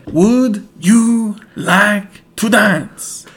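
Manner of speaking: very slow, loud and high, word by word; mock talking-to-a-foreigner delivery; exaggerated slow speech. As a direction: spell it out for someone who speaks no English. Acting out talk to French girl.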